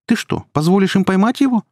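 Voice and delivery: high-pitched incredulous reproach; girl's high, outraged question; indignant. Acted. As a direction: challenge him in disbelief, voice way up. Kira indignantly challenges her boyfriend.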